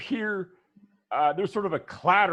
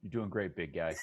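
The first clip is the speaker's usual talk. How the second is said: Ted; 7 semitones lower than usual. Acted. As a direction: murmur dry praise in a deep voice. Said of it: "uh, Ted says".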